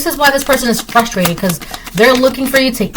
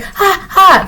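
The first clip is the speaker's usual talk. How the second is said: mock frantic panting; 10 semitones higher than usual. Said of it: "That frantic".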